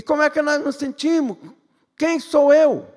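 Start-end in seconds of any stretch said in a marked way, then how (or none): 2.0-2.9 emphatic preaching. Acted. Preacher's rhetorical climax.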